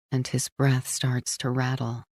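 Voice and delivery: plain reading voice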